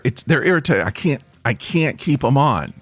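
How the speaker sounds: ordinary talk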